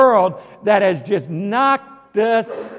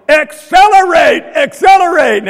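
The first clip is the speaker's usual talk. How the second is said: high, loud sports-fan shout; re-enacted full-voiced cheer; excited yell; 9 semitones higher, 10 dB louder than usual. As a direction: yell it at the runner on TV, stretching the word. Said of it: "I started yelling".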